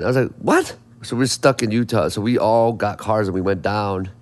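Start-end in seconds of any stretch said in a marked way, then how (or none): 0.4-0.7 high-pitched incredulous what; retold disbelief. Musician retelling being abandoned by bandmate, incredulous. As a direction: squeak it out in mock disbelief.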